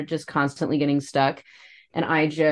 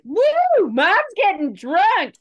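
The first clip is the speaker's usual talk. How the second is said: very high, loud party whoop; imitating tipsy excitement; 14 semitones higher, 5 dB louder, slower than usual. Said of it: "when I just have a little bit of alcohol, I'm like".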